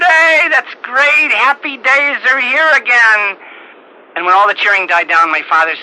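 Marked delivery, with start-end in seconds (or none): 0.0-3.4 high, loud, slow cheer. Retold or imitated. Family cheering the mother's new job, drawn-out joyful shout. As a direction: cheer it out like the whole family celebrating. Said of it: "And everybody cheered".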